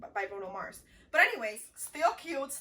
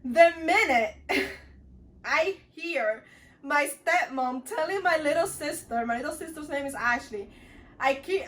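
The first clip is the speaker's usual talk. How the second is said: ordinary talk, nothing marked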